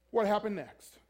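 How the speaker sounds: usual speaking voice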